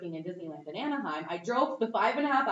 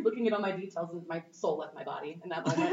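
ordinary delivery

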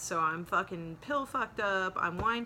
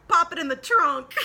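higher, louder breezy order; loud cheeky command; 8 semitones higher, 11 dB louder than usual. Retold or imitated. To the deputy holding her bumper, a loud cheeky order. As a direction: toss it off like a drunk queen.

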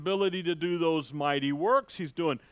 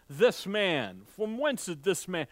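ordinary talk, nothing marked

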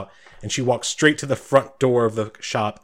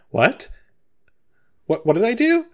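high-pitched, bewildered protest; startled, much higher character voice; confused Sabine; 12 semitones higher than usual. Acted. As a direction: squeak out a baffled question, stumbling on the first word. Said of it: "like, very confused".

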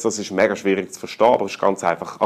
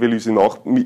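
ordinary talk

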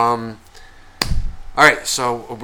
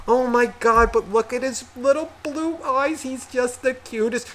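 gushing relative; 12 semitones higher than usual. Retold or imitated. Relative admiring the baby's eyes, gushing. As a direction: coo over a baby in a high put-on voice.

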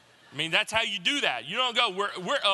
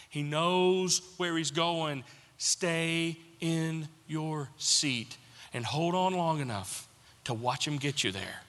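a pastor comforting the hurting; reassuring; slower than usual. Real, not acted. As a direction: gently tell them to hold on. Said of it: "pastoral comfort".

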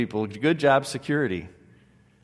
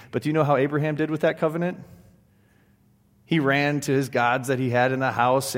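ordinary delivery

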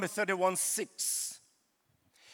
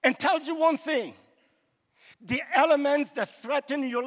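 louder, higher rising sermon; full-force preaching; 4 semitones higher, 5 dB louder than usual. Real, not acted. Preacher promising the church Jesus is in every storm, full force. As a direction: build it up, preach it out.

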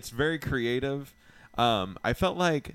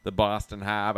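put-on Boston accent; 4 dB louder than usual.

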